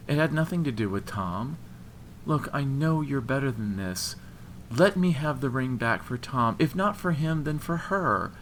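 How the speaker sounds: high, earnest appeal; pleading. A narrator's reading. Woman begging for the ring back for friends, pleading. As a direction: beg with the pitch lifted.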